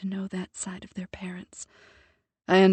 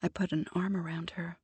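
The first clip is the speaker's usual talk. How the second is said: usual speaking voice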